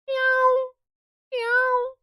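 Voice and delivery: high squeaky cat meow. Shown as a demonstration. Teacher makes a cat sound for students to guess, high mewing. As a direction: mew like a kitten, high and thin. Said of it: "ये जो sound मैंने बोली थी".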